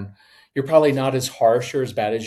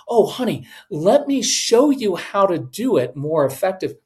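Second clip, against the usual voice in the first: raised pitch, over-helpful parent; interfering parent; 5 semitones higher than usual. Acted. Parent butting into a child's project, eagerly over-helpful. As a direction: swoop in with higher-pitched helpfulness. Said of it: "you come in and you're like".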